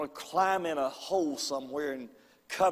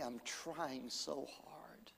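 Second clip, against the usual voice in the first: breathless, weeping whisper, slow; a retold sob-choked plea, barely voiced; tearful whisper; 16 dB quieter, much slower than usual. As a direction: whisper it through tears as she retells it. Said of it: "she was crying her eyes out".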